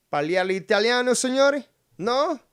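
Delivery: high, loud hammed-up Italian accent; taunting in showy Italian, pitch soaring; mock Italian. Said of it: "you wanna claim Italian, you can't even fucking speak?".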